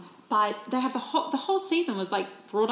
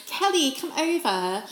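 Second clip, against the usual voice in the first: mock British accent; 5 semitones higher, 8 dB louder than usual. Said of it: "It's the only accent I can do".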